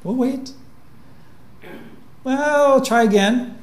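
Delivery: sweet and endlessly patient; gentle robot voice. Retold or imitated. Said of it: "The robot says".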